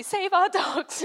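high, slow, loud slogan; hammed-up campaign chant; mock rallying cry. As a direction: chant it like a protest banner.

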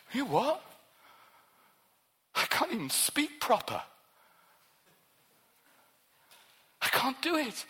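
lightly voiced Moses pushing back at God; imitating Moses protesting. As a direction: play Moses pleading he can't speak. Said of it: "Did the same with Moses".

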